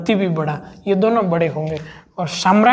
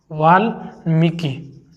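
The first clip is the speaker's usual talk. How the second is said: Valmiki drawn out very slowly; slow correct spelling; 3 semitones lower, much slower than usual. Shown as a demonstration. Teacher dictating Valmiki slowly to students. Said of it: "Valmiki शब्द".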